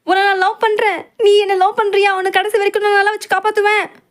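high, loud, near-tearful love confession; blurted confession. Acted. Loli finally confessing love to Queen, near tears. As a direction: blurt it all out in one desperate rush.

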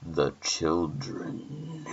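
slow, drawn-out creepy phone voice; menacing caller. Acted. Stranger on the phone taunting a babysitter, slow and menacing. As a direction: stretch each word out menacingly.

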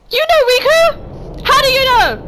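high, loud, startled questioning; shocked demand. Real, not acted. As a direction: blurt it out in real shock. Shocked reaction to game villain.

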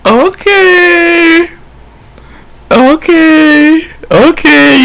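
high drawn-out sing-song okay; mock-calling deflection. Real, not acted. Calling out his friend's deflection, slow and high. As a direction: stretch each word to mock him.

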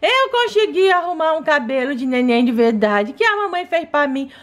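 usual speaking voice

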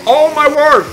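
high, loud exclamation; outraged cry. Pretend. Driver whose truck got broken, outraged cry. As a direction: burst out in play disbelief.

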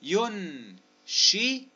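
very slow spelling-out of "ينشئ"; syllable-by-syllable. Shown as a demonstration. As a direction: break "ينشئ" apart with gaps, each syllable held. Sounding out word for spelling.

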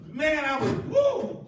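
usual speaking voice

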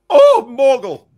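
loud, high-pitched exasperated cry; exasperated outburst. Real, not acted. Exasperated reaction to reading viewer's comment.

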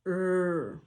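"R" as a held American er sound. Shown as a demonstration. Teacher models the sound in Thursday, American r. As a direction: sustain a curled, untrilled "R". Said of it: "Następnie mamy amerykańskie r".

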